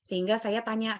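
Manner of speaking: ordinary talk, nothing marked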